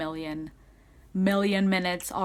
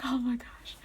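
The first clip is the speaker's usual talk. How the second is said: higher, hushed swoon; touched whisper; 4 semitones higher, 6 dB quieter than usual. Retold or imitated. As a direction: whisper it as a moved fan, re-enacted. Retells her touched reaction.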